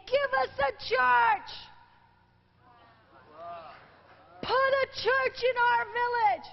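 retold crowd chanting for a church; crowd shout. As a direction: cry it out like a pushing crowd. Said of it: "and they started screaming".

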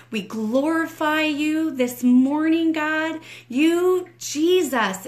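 high, loud cry of praise. Acted. Director glorifies God at the height of prayer, loud high praise. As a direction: lift your voice in full praise.

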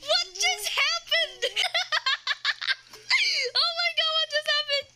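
high gasps and laughing disbelief; gasp, then laugh in disbelief; shocked gasping. Real, not acted. Shocked by the flush.

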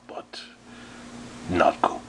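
soft unvoiced whisper; whispered aside. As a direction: hiss it under your breath.

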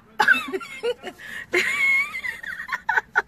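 very high, wailing laugh-cry; laughing sob. Real, not acted. Driver laughing till it sounds like crying, giddy. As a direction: let a laugh break into a wail.

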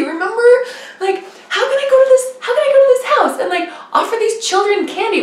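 high, fast, frantic pleading with God; panicked pleading. Retold or imitated. Retelling her frantic prayer to Heavenly Father, high and fast. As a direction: squeak out a flustered protest.

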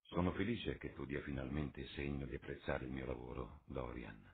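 chilly, clipped-back reply; icy voice. A narrator's reading. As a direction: answer coldly after the shock. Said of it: "con voce gelida".

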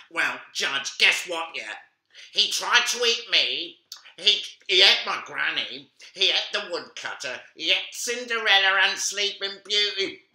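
put-on high, loud eager girl voice; a bratty little girl telling on him; tattletale girl.

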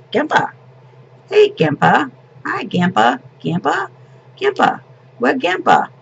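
put-on high, eager toddler calls; imitating a toddler. Grandma mimicking her grandson's all-day calls for Grandpa, toddler voice. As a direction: pipe up like a little boy pestering for Grandpa. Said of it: "That's all we heard all day from Paxton".

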